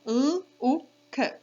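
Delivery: "L, U, K" as separate sounds, held l, short oo, kuh; sounding out. Shown as a demonstration. Teacher does the sounds of look, segmented "L, U, K". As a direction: break "L, U, K" into phonemes with gaps. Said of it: "Let's do the sounds".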